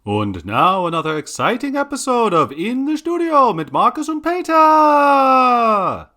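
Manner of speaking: put-on big German-accented announcer, swooping pitch; show announcer. Announcer introducing another exciting episode, grand German-accented flourish. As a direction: announce it grandly, stretching the final names.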